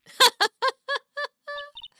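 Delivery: shrill burst of laughter; high squealing laugh. Real, not acted.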